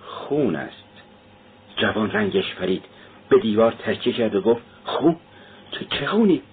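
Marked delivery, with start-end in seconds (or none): 0.0-1.0 slow, softer murmur to herself; half-said, grim and slow. A narrator's reading. Maid muttering to herself about the blood, slow and soft. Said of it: "دختر جوان با خود گفت". 4.8-6.4 higher, startled stammer; shocked question. Acted. Pale young man stammering at the maid, frightened.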